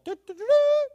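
sung quick high toots like a trumpet; mock short trumpet toots. Shown as a demonstration. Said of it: "isn't just a quick little".

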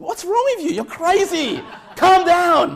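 a hint of a spooked man at the door; alarmed neighbor. Neighbor reacts to shouting preacher.

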